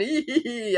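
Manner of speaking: a little squeaky giggle from across the room; mock tee-hee giggle. Shown as a demonstration. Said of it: "And then".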